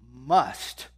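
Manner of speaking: must punched loud and falling; stress on must. Acted. Hit the word must. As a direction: pastor to congregation, stressing must hard.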